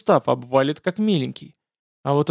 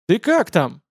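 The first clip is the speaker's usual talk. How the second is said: calling out; 6 semitones higher, slower than usual. A narrator's reading. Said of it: "тут же озабоченно крикнули с улицы".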